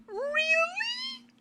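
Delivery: very high, breathy really; gushing high really, imitating himself; mock excited squeal. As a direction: squeal it like a thrilled kid. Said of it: "So I went".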